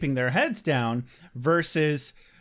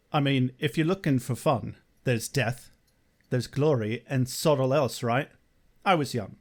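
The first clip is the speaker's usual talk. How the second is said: imitated Spike accent; 3 semitones lower than usual. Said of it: "coached James Marsters for Spike's accent".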